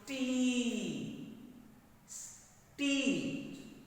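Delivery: "T" drawn out with extra force; stressed first syllable. Shown as a demonstration. Teacher shows students the stronger syllable, "T" stressed and held. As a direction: hit "T" hard and hold it. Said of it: "first syllable is pronounced".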